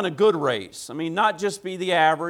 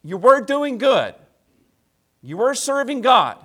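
higher, louder preacher's voice as Paul rebuking the Galatians; Paul pleading; 4 semitones higher, 5 dB louder than usual. Retold or imitated. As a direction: plead with them, disappointed and impassioned, raised pitch. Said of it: "He's saying".

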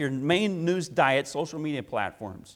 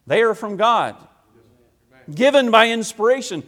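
louder, higher, rising to a pulpit cry; full-force preaching; 7 semitones higher, 9 dB louder than usual. Acted. Pastor rebukes the church for wanting stories, preaching at full force. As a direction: preach it hard to the congregation.

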